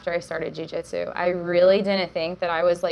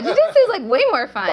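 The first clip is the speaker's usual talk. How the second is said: excited; 13 semitones higher, 6 dB louder, faster than usual. Retold or imitated. Excited repeat of her choice.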